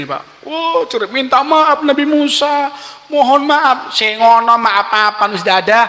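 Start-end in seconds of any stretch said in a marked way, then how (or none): 0.4-3.9 higher, desperate begging; half-acted cry for mercy; sinking men begging Musa for forgiveness; mock screaming plea. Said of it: "ini jerit-jerit ini".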